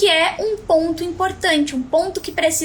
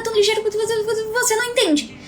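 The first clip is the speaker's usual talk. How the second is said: fast, higher-pitched gabble; rushed speech; 6 semitones higher than usual. Shown as a demonstration. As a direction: race through it like someone who never pauses for breath. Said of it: "falam de uma forma tão rápida". (fala rápida demais, atropelada)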